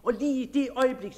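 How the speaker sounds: ordinary delivery, nothing marked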